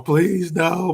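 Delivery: imitating Ted Bundy crying for mercy; mock begging. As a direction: ham up a killer begging for mercy. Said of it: "for him to be crying and".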